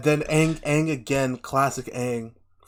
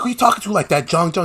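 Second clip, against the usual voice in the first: higher and louder indignant scolding; Roku scolding; 6 semitones higher, 6 dB louder, faster than usual. Retold or imitated. Roku scolds Jeong Jeong, indignant and louder. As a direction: demand who dared speak so. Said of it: "Roku was like".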